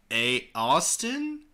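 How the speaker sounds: slow, loud questioning lift; acted bewilderment, rising high at the end; confused, tired. A narrator's reading. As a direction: draw out the name as a confused question. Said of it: "in a confused and tired voice".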